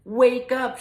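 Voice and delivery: yelled. Acted. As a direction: shout at the leaf to wake. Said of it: "she yelled".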